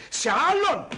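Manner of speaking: indignant repeat. Acted. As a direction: hammer the refusal home. Angry repeat, refusing to explain. (αγανακτισμένη, εμφατική επανάληψη)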